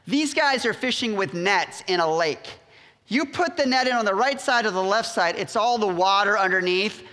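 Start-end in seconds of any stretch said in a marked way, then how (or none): none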